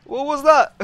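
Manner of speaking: loud, high startled question; shocked. Real, not acted. Player asking right after a scare, shocked. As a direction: blurt it out, jolted by a fright.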